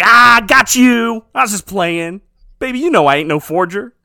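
loud, hammed-up husband playing innocent; mock playful gotcha. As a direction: play it off loudly as a joke. Retold husband laughs off wife's anger.

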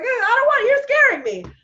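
high, loud frightened whimper; scared child. Retold or imitated. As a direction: whine like a scared little kid. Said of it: "I'd be like".

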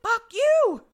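high, loud shouted retort; mock angry shout. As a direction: hurl it out, imitating a furious outburst. Acted defiant outburst at the group.

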